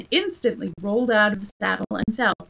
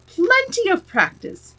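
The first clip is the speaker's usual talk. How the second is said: slow, higher, insistent knight; the knight repeating; 10 semitones higher, 4 dB louder than usual. A narrator's reading. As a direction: drag it out from the ground, higher. Knight repeating his line.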